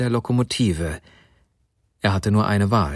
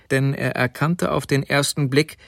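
ordinary delivery

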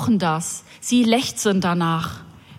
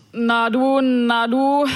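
put-on drunken slurring; 2 semitones higher, slower than usual. Drunk man on the night bus calling out, slurring. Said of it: "lallte mir ein Daunenjackenträger".

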